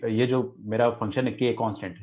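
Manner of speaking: normal talking voice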